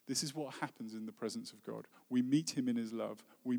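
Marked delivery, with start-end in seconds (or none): none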